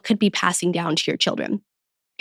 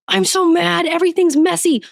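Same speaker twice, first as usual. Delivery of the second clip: higher, exasperated mock rant; mock frustrated outburst; 9 semitones higher than usual. Mom voicing her own fed-up thoughts about the messy house, mock exasperated.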